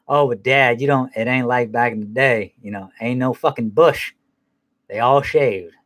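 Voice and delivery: normal talking voice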